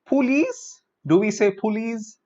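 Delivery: "police" as poo-leez, rising; wrong pronunciation. Shown as a demonstration. Teacher mocking the common police mistake. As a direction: say "police" with a full oo in the first syllable. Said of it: "incorrectly pronounced".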